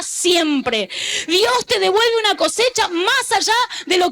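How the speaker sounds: high, impassioned preacher's voice; fervent pulpit proclamation; full-force preaching. Acted.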